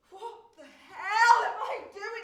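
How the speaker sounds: shouting "What the hell" at herself; furious shout. Acted. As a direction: tear into yourself at full volume. Bursts out at herself.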